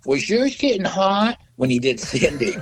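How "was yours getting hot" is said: high, drawn-out mock question; imitating the drummer. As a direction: imitate him asking in a raised, slow voice. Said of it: "you hear him going".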